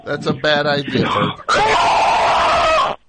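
rising panicked yell; alarmed shout. Pretend. Friend about to ride bear.

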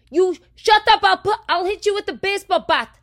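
neighbor's screamed threat, high and loud; imitated threatening yell. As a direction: bellow the threat fast. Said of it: "He goes".

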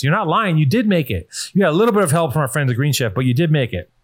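ordinary talk, nothing marked

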